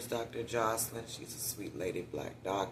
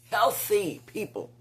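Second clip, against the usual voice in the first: HEALTHY stretched out slowly, high and punched; emphatic repeat; 8 semitones higher, 5 dB louder than usual. Real, not acted. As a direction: lean hard and slow on "Healthy".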